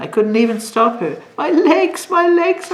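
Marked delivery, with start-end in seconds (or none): 1.4-2.7 high, loud cry of pain; a child crying about her legs. A narrator's reading. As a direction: wail it like a scared child. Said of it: "I cried".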